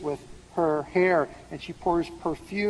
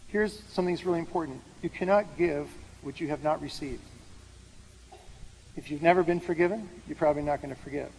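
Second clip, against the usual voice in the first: usual speaking voice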